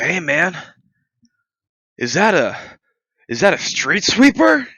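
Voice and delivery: amazed homeless man asking about the truck, imitated; imitating homeless guy. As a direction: play the curious stranger at the hydrant. Voicing homeless guy in story.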